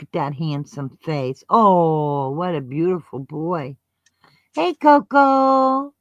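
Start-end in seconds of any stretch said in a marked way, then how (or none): 4.5-5.9 slow, high, louder cooing; dog talk. Real, not acted. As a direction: stretch the name out to the puppy.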